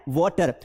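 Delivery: "water" repeated with a rounded aw; correct "water". Shown as a demonstration. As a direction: answer with WAW-ter, vowel rounded. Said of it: "क्या बोलना है?".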